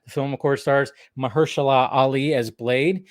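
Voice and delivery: ordinary talk, nothing marked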